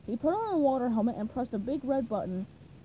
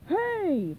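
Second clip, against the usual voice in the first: irritated, raised "Hey"; sharp, higher exclamation; snapped; 6 semitones higher, 4 dB louder than usual. A narrator's reading. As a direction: snap it out, pitched up. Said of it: "Patrick snapped".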